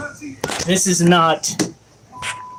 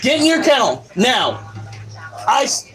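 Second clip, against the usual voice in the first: real stern scolding; 9 semitones higher than usual. Real, not acted. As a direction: order the misbehaving dog off to its kennel. Scolding dog Wolf for yelling inside.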